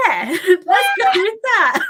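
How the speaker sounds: high, loud, drawn-out laughing reply; retold gleeful yes, breaking into laughter; laughing agreement. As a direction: laugh through it, loud and slow. Retells laughing reply to mum.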